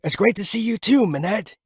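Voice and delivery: a touch of excitement; excited Zalman. Acted. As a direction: glad to see an old friend. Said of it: "said Zalman excitedly".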